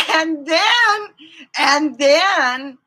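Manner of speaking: and then, and then called out high and loud; excited buildup. Real, not acted. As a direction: build suspense before the punchline. Building to the marriage story.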